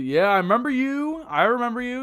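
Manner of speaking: light up remembering the name; excited recognition. Real, not acted. Streamer spotting an old donor, excited.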